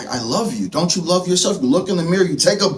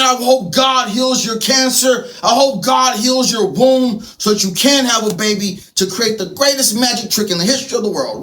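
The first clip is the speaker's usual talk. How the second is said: usual speaking voice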